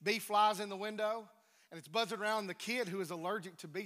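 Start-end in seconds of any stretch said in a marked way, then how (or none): none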